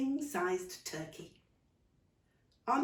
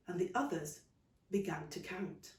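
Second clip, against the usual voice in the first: ordinary delivery, nothing marked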